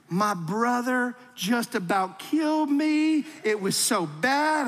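imitating a tattling child; tattling kid. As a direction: whine like a kid telling on a sibling. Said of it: "kid comes to tattle".

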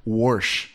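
"Warsh" with an intrusive R; wash said as warsh. Shown as a demonstration. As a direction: put a hard R into wash, "Warsh". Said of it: "You know what I fucking hate?".

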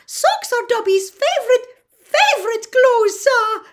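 high, loud squeaky elf joy; excited house-elf. Acted. Dobby overjoyed.

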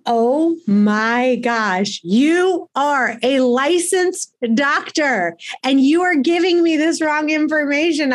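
a mock aghast thought, stretched out slowly; incredulous inner thought. Recalling her shock at the endocrinologist, slow and incredulous. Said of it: "And I'm thinking to myself".

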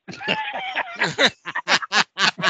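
long high-pitched laughing fit. Real, not acted. Storyteller recalling his collect calls from Tokyo, high laugh. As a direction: laugh high, tickled by your own prank.

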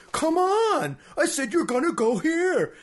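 high, loud imitation of a raging alien; hammed-up furious shout; angry yelling. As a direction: yell it like an alien with anger issues. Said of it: "he's just yelling the whole time".

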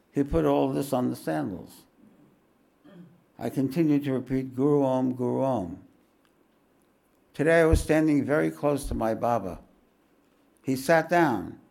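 fuller, brighter storybook reading; devoted first-person narration; memoir reading. Acted. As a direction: read the story to the room with wonder. Reads the memoir aloud.

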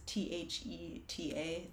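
slow, soft letter-by-letter spelling. Shown as a demonstration. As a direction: spell each letter slowly. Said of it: "this group called Theta Thoughts".

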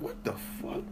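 whispered aside. Retold or imitated. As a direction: whisper it to yourself in disbelief. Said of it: "I'm like".

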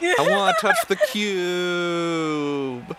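put-on very high, loud childish whine; whiny little kid. Crying child begging to touch the cube, whining. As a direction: pine for the shiny cube.

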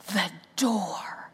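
hushed whispered reveal; mysterious whisper; dramatic whisper. Retold or imitated. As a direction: whisper it like a movie title.

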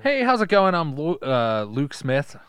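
high, chirpy hello that settles down; mock self-introduction. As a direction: introduce yourself as an ordinary hero. Plays Luke with a dull name.

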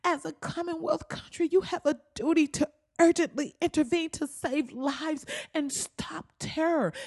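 high-pitched mock letter writer; mock plea. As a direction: read it as a put-on pleading voice. Mocking read of the plea letter.

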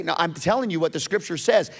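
ordinary talk

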